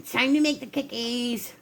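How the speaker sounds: soft imitated advert wake-up call; commercial guy voice. Baker imitating husband's cookie wake-up, commercial-guy voice. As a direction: mimic the donut man's call, softly. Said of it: "in the same kind of voice".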